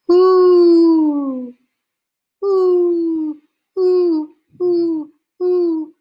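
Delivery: high, loud hooting owl call. A narrator's reading. Father in the woods calling to the owl, high hooting. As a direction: call out like a great horned owl. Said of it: "and then Paul called".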